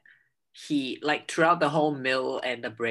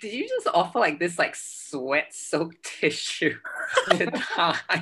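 laughing disbelief; 4 semitones higher than usual. Retold or imitated. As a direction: laugh through the accusation as if it's absurd. Mock disbelief at the tissue.

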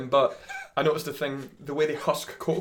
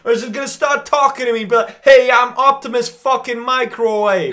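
angry rant; 10 semitones higher, 11 dB louder than usual. Acted. Comic rant, shouted.

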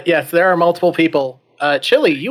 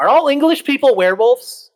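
high, loud incredulous blurt; astonished question; 12 semitones higher, 7 dB louder than usual. Acted. Hero interrupting his British friend, astonished blurt. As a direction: burst out with sudden disbelief.

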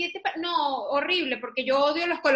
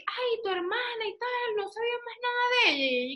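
mock sweet question; 9 semitones higher, 3 dB quieter than usual. Daughter imitates her mother asking about a dead sister, high and sweet. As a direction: ask warmly, unaware.

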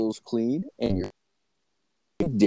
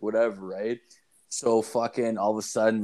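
ordinary talk

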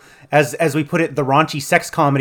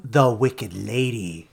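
trailer voiceover title; 6 semitones lower, 7 dB quieter, slower than usual. Retold or imitated. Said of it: "trailer voiceover here".

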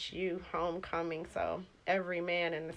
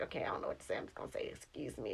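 nervous guy's inner voice; 9 semitones lower, 9 dB quieter, faster than usual. Retold or imitated. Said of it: "he's like".

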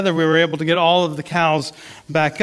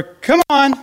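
much higher, louder imitation of the uncle calling cows; uncle's high cow call; 8 semitones higher, 4 dB louder than usual. Imitating the uncle calling cows up the hill, high and loud. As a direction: pitch it way up like the uncle calling the herd. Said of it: "my uncle's voice is kinda higher than my voice".

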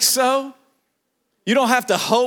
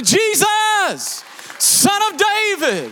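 higher, slow, shouted plea to Jesus; high drawn-out shout of Son of David; desperate cry; 9 semitones higher, much slower than usual. Acted. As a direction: cry out like a blind man who won't be silenced. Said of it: "a kind of cry that came from somewhere deep".

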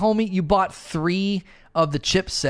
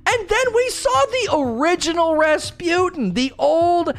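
hurt story dev; 12 semitones higher than usual. Retold or imitated. Story team defending their lore to critics, high hurt pleading. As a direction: plead up high like a wounded writer. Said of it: "They'd have to be so angry".

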